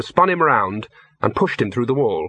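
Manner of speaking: usual speaking voice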